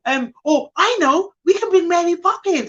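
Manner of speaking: high, eager child imitation; little boy. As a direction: play a kid hatching an idea. Voicing little boy's bright idea.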